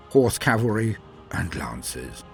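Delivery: usual speaking voice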